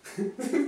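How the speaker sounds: high burst of laughter; real laughing fit. Real, not acted. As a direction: laugh helplessly, high-pitched. Breaks up laughing.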